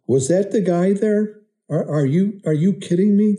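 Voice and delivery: can't believe she found him; disbelief. Retold or imitated. Son to his mother, amazed she found the trip leader.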